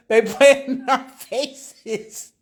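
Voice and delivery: slow, stretched through laughter; laughing. Real, not acted. Streamer repeating the line while cracking up, slow laughing. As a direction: let the laugh drag the words out. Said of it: "I can't stop laughing".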